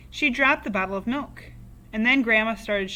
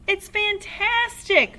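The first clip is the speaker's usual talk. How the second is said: high, loud, slow ecstatic shout; dance about crowing with delight; excited shout; 12 semitones higher, 5 dB louder, slower than usual. A narrator's reading. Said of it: "Mister Cranky shouted".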